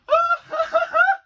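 high squealing laughter; hysterical happy laugh. Real, not acted. Fan overcome by the trailer, hysterical happy laugh. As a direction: burst into a shrieky, overjoyed laugh.

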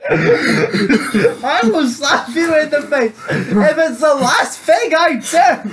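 mock villain vow; hammed-up threat. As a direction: drag out every word of the threat like a melodrama villain. Repeating the threat, drawn out.

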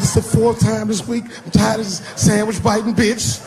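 put-on high whiny indignant kid voice; fed-up kid. Son griping to dad about the sandwich thief. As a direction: complain up high like a wronged boy. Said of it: "He said".

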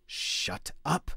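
hissed snarl. A narrator's reading. Grotti silencing the quarrelling elders with a snarl. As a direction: bite it off at them. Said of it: "Grotti snarled".

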